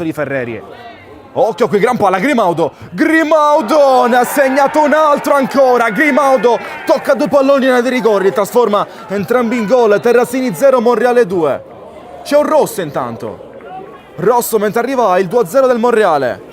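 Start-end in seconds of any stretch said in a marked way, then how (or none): 1.3-11.6 high, loud excited goal call; goal commentary shout. Real, not acted. Goal scored, commentator erupts. 12.2-16.5 excited. Real, not acted. Red card amid second goal.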